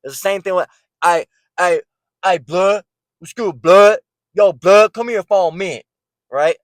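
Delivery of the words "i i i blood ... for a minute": high, loud, slurred street-accent imitation; mock the street accent with swallowed consonants.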